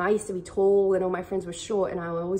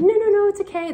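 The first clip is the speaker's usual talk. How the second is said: much higher and louder, brushing it off; deflecting a compliment; 12 dB louder, faster than usual. Retold or imitated. Someone brushing off praise, the Australian way, high and loud. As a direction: wave the compliment away, squeaky and loud. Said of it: "That's so Australian".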